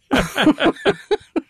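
high, rolling laugh; amused run of high laughs; real laughter. Real, not acted. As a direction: laugh at your own story. Laughs at own anecdote.

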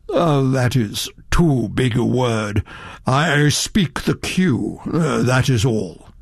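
lightly voiced, measured and unhurried; calm mysterious stranger. Quinn slow modest.

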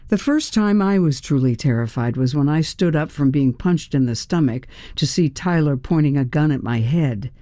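plain reading voice

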